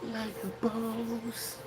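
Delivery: boss voice. Pretend. Boasting catchphrase.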